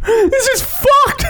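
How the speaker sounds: high, slow fake sob; mock crying. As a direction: whimper it like you're broken. Mock distress at loud speakers.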